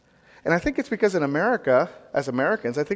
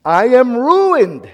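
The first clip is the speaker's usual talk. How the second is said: slow, loud, higher cry of dread; terrified; 6 semitones higher, 6 dB louder, much slower than usual. Acted. Preacher voicing Isaiah's dread, slow loud cry. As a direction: wail it like a doomed man. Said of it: "is the next part he says".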